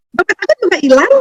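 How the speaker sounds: high, bright-hearted mock cheer; cheerful telling. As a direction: tell the loss as if it were good news. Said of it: "tapi mukanya ketawa". (nada riang dan tinggi, seolah kabar gembira)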